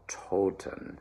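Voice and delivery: "Toten" with a big round open O, slow. Shown as a demonstration. Coach showing the choir the open O, slow. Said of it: "nice big round open O".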